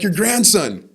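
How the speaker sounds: high, loud, insistent retelling; retold phone shout. Grandson insisting who he is to grandfather, retold shout. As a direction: shout it down the line, imitated.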